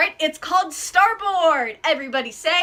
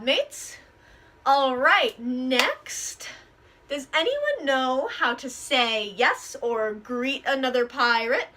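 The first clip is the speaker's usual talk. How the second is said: usual speaking voice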